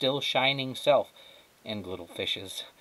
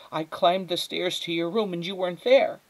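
high-pitched distress, louder at the end; upset mother; 9 semitones higher, 5 dB louder than usual. Acted. Mother tells Flora of the empty room, upset. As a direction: build toward the frightening discovery.

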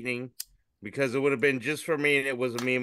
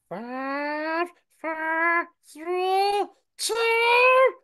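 very high-pitched germ countdown; tiny germ voices; 5 dB louder, much slower than usual. Acted. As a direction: squeak each number as a tiny germ, building louder to the last. Germs in a comic count down.

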